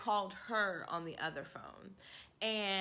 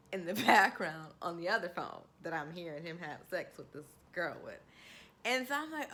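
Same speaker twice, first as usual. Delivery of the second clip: ordinary delivery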